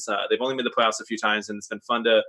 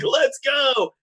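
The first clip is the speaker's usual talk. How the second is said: hyped reply; 12 semitones higher than usual. Retold or imitated. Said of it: "And I was like".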